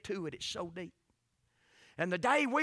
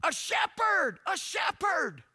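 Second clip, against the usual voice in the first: mild mock holler; 9 semitones higher, 6 dB louder, slower than usual. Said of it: "she started hollering".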